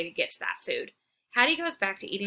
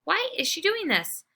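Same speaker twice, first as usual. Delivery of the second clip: whiny; 8 semitones higher than usual. Acted. Dog's anguished thought.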